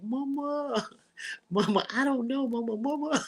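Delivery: put-on high, broken childlike whimper; little girl crying. Daughter falling apart at her mother's door, high childlike whimper. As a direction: sob it out like a lost little girl. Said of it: "I felt like a little girl when she opened the door".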